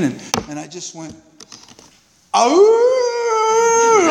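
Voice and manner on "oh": long high howl; high mournful werewolf howl. Retold or imitated. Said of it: "like a werewolf".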